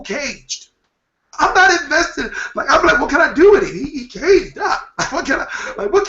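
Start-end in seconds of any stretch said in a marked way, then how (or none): 1.4-6.1 laughing. Real, not acted. Joking about an untested fighter.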